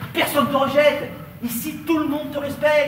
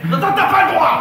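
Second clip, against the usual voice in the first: loud, higher, cutting him off; angry shout; 6 semitones higher, 6 dB louder than usual. Acted. Quarrel escalates.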